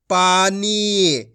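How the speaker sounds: पानी very slowly, both vowels held; drawn-out long vowels. Shown as a demonstration. Teacher models the joined word, vowels drawn out. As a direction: stretch पानी out syllable by syllable. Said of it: "खींच के पढ़ेंगे".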